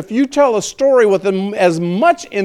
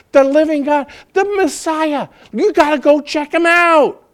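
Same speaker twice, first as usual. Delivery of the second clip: higher and louder, breathless with joy; bursting with excitement; 6 semitones higher, 4 dB louder than usual. Retold or imitated. The woman running back to tell the town, thrilled and loud. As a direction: gush out the wonderful news. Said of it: "And she was excited".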